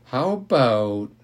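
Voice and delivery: drawn-out, slow how about; slow start. A narrator's reading. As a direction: let "How about" hang slowly. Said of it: "Leslie began slowly".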